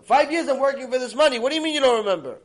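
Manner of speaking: loud, high, tumbling outburst; losing his temper. Retold or imitated. Guest who fears his savings are gone, acted temper outburst. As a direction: shout in mounting outrage, words piling up. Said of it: "losing his temper".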